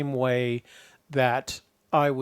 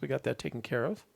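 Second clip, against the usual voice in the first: ordinary delivery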